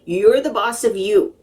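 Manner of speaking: ordinary delivery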